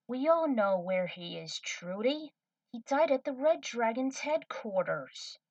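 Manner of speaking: lightly voiced Faye, correcting her firmly; Faye's voice. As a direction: Faye telling Trudy that Spike died. Faye answers Trudy.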